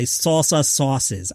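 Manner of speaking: slow, plummy British accent on "salsa sauces"; posh English accent. Shown as a demonstration. Podcast host mocks the press-release phrase, slow British accent. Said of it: "go into the Queen's accent".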